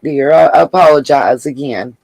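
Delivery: normal talking voice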